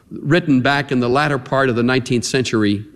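ordinary talk

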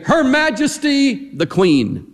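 slow, grand herald proclaiming titles; royal announcement. Shown as a demonstration. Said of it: "It's like we would say".

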